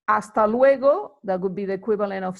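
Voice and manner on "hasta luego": ordinary delivery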